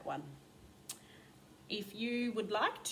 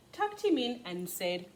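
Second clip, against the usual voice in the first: usual speaking voice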